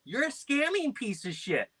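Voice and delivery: high, mock insult; put-on prank retort. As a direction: fire back in a silly high voice.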